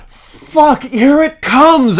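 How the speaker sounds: high, slow straining shout. Acted. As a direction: belt it out at full force. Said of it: "[name] shouted".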